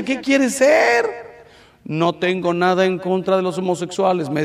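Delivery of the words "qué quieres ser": high, slow, sing-song "¿qué quieres ser?"; drawn-out sarcastic query; mocking question. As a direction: mimic a silly offer.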